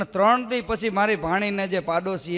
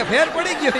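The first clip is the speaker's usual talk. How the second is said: high, laughing mock relief; laughing patient; 7 semitones higher than usual. Scared patient insisting to the doctor he is cured, laughing. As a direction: blurt it out through laughter.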